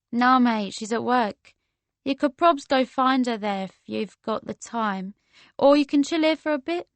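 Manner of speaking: amused, incredulous London voice; chatty London woman. Acted. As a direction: rib a friend's name in a London accent.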